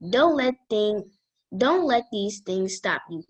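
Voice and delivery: ordinary talk, nothing marked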